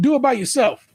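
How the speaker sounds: high, mock-indignant dismissal; sassy retort. Refusing the sister-in-law's childcare, sassy mock retort. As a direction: toss it off high, playfully telling them off. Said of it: "I'll be like".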